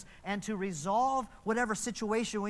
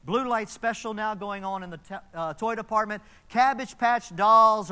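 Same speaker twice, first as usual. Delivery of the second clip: imitated store PA announcement, building louder; store announcer; 3 semitones higher, 8 dB louder than usual. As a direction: announce the sale to the whole store. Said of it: "front service desk personnel say".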